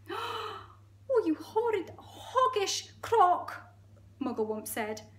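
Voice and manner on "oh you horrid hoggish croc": high, slow squealed insult; outraged monkey. Acted. Monkey cursing the crocodile, high outraged squeal.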